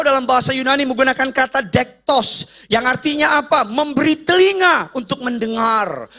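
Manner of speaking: ordinary talk, nothing marked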